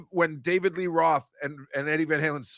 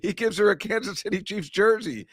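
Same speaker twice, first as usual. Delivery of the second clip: ordinary talk, nothing marked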